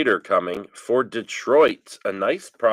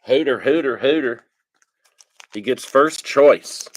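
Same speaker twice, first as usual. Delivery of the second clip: calling out; 4 semitones higher, 6 dB louder than usual. Real, not acted. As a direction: shout a name for attention. Calling a viewer's name.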